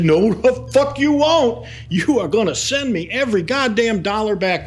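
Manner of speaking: high-pitched retold outburst; John screaming. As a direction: imitate an enraged client demanding a refund, voice shooting up. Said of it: "he starts screaming at me".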